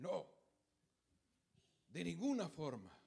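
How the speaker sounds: retold stubborn, angry "no"; obstinate angry refusal. Preacher voices the defenders refusing Titus's plea, mildly angry and obstinate. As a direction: refuse firmly, as if quoting stubborn rebels. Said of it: "más obstinados y más enojados decían".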